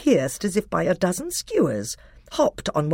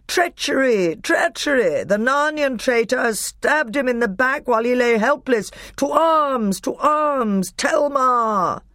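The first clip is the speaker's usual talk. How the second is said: high, ringing alarm call; crying out; 8 semitones higher than usual. A narrator's reading. Lords accusing Narnians of treachery, high rallying cry. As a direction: raise the alarm to a whole army.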